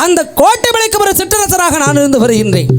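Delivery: royal proclamation. Acted. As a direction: announce it like a king on stage. King proclaims his rule, cheered. (உயர்ந்த குரலில் அரச அறிவிப்பு)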